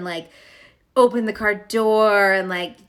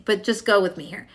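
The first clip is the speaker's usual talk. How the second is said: ordinary talk, nothing marked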